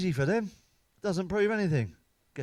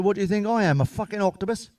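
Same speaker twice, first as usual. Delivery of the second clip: indignant shout; 4 semitones higher, 7 dB louder than usual. Retold or imitated. Indignant reply to wife.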